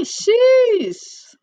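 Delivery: breathy whispered sheesh. Real, not acted. Whispered reaction.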